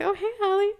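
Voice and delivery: very high, sing-out hello; airy put-on greeting; mock casual greeting. Voicing classmates' casual greeting.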